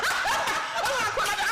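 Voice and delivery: high, slow, drawn-out whoop of a line; retold elation. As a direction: crow it slowly through laughter. Retold reaction to big check.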